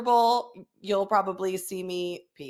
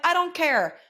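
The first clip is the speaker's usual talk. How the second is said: higher, emphatic retold dismissal; stern mother scolding; 7 semitones higher, 3 dB louder than usual. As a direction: cut off a child's excuse, mildly stern.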